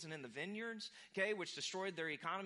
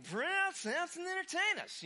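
put-on much higher, loud drunken holler; drunken shout; 12 semitones higher, 7 dB louder than usual. Drunk Philistines calling for Samson, loud slurred holler. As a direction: yell it like a rowdy drunk crowd. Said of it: "They shouted, uh, probably slurred".

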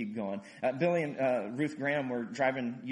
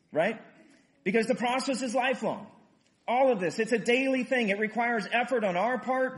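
higher, louder preaching push; preaching at full force; 6 semitones higher, 5 dB louder than usual. Acted. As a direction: drive the point home to the church. Rising sermon climax.

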